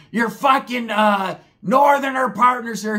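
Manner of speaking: high, loud, dragged-out mom yell; mom screaming. Retold or imitated. Mom screaming at her son from the house, high loud yell. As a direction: yell it out the window like an angry mom.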